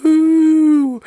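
a high, loud party whoop; drunken whoop. Retold or imitated. Telling a friend how tequila hits him, whooping. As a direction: let out a wild tequila woo.

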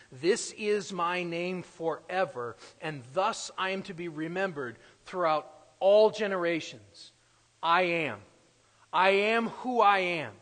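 building loud proclamation; preaching at full force. Acted. Preacher voicing God at the burning bush, building loud. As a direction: thunder out the divine name.

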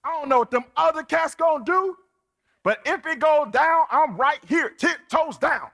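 high, loud, fast street bravado; boastful Peter. Retold or imitated. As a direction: swear loyalty like a hype man. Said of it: "Peter was like".

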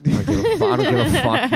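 laughing dismissal. Real, not acted. Trashing a fellow comedian.

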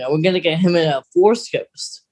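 normal talking voice

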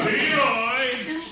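high call across the street. Acted. Uncle calling Leroy home for dinner, high call.

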